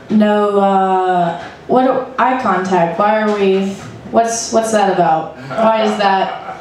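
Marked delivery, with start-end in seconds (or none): none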